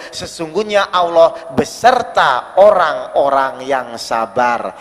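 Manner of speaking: slow and low, drawn-out recitation; solemn verse. Acted. Preacher closing his point, slow low solemn verse.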